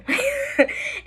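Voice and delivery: very high burst of laughter; amused laugh. Real, not acted. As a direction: let out a high laugh mid-story. Laughs telling of laughing homegirl.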